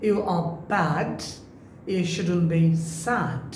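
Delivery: "bad" with a wide open "a" and "sad" drawn out slowly; careful standard-English take of "You are bad"; corrected pronunciation. Shown as a demonstration. Teacher showing students the right vowel in "bad", slow and careful. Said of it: "কণ্ঠটা, টোনটা একটু different".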